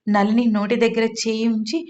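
ordinary delivery, nothing marked

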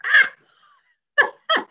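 sudden high squeal of delight; excited squeal. Real, not acted. Teacher squealing when she gets overexcited coloring.

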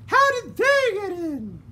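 put-on high, slow, prim old lady voice; a genteel lady fretting in the dark; voiced lady character; fussy lady. A narrator's reading. Lady Dandelion.

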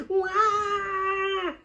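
high, loud drawn-out howl; baby howl. Acted. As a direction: howl like a piglet in tears. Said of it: "howled Baby Pig".

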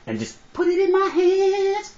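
very high, drawn-out preacher's wail; hammed-up falsetto pulpit plea; comic preacher. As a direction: stretch the last word into a high wail. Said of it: "talk like this brother being a preacher".

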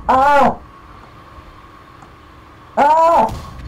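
short high yells of alarm; high gamer scream; panicked scream. Real, not acted. Under attack in game.

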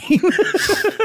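bright high laugh. Real, not acted. Laughing at the quote.

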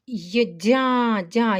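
дя drawn out with a soft д; isolated soft syllable. Shown as a demonstration. Teacher isolates дя for pupils. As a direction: pull the syllable дя apart to show its softness. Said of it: "я передало м'якість".

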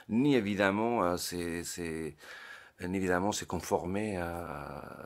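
ordinary talk, nothing marked

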